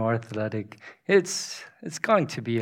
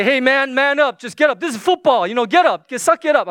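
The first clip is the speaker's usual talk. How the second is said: loud, high mock coach urging; retold tough pep talk; 10 semitones higher, 7 dB louder, faster than usual. Dad telling crying son to toughen up, loud and high. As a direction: play the exasperated dad rallying a kid.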